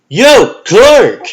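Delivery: loud call across the room, pitched high. Acted. Jimmy calling across the newsroom to Clark, loud and high. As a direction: hail him from across the newsroom.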